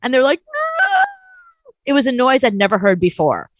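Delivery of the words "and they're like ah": high mock anguished cry; short hammed-up scream; imitated wail. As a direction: mimic a movie mother's wail. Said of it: "movies where they're ripping a baby out of a mother's arms".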